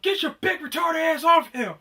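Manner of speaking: high, loud mocking shout; angry yell. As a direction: yell across the gym to pull him off. Retold shout at fighter.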